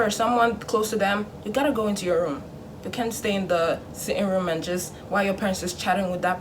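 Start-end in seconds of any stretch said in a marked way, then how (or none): none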